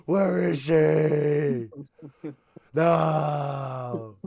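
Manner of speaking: long, high anguished shouts; hammed-up melodramatic despair; crying to the heavens. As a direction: wail each word up to the heavens. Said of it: "like, crying to the heavens".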